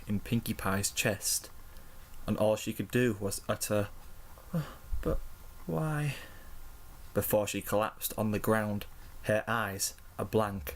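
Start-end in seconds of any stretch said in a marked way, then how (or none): 5.0-6.1 faint, higher-pitched last question; dying disbelief. A narrator's reading. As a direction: utter it as if collapsing from a wound. Dying pony utters last words.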